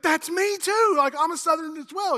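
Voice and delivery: high, loud, excited yell; thrilled match. Acted. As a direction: cry it out high and loud, overjoyed. Acted joke, thrilled at match.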